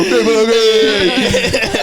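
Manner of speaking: sudden high bright call of hey; excited mock greeting; mock shout. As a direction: shout it out like spotting someone in the street. Mocking shout at Purple Aki.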